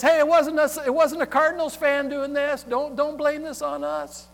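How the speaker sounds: high, loud mock protest; fan's protesting defense. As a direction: plead innocence over a noisy crowd. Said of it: "trying to quiet the crowd and, and, and the defense".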